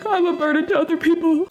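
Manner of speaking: high wobbly mock of herself; mock her own teary voice; shaky nervous falsetto. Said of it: "and I'm like".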